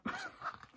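high, breathless little giggle; giddy laugh. Real, not acted. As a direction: burst of overwhelmed giggling. Giddy over the photos.

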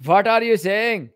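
ordinary talk

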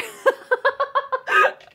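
high laugh. Real, not acted. Laughs at own line.

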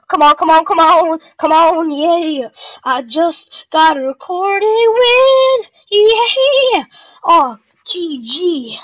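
loud high victory yelling; excited shouting. Real, not acted. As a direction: yell it out as you win. Winning the final fight.

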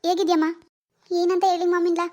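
ordinary talk, nothing marked

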